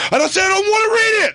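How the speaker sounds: shrill high yell of a guest refusing to read; mock angry shout. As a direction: blow up like a guest who won't read the script. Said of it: "He goes".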